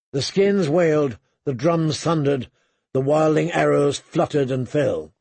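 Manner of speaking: ordinary delivery, nothing marked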